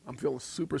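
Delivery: ordinary delivery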